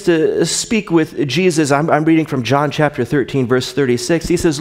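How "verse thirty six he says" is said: normal talking voice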